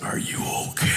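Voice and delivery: hushed, voiceless whisper. Acted. Friend on the golf call checking on him, whispering. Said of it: "And then he whispers to me".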